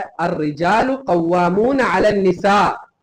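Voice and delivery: drawn-out Quranic chant; Quranic recitation. Acted. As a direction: recite the verse slowly, like tilawat. Quotes the Quran mid-sermon.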